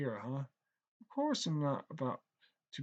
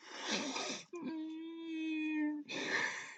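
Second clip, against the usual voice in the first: long sigh; 13 semitones higher, 4 dB quieter than usual. Acted. Long sigh after insult.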